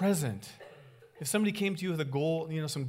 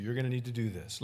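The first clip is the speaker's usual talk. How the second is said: ordinary talk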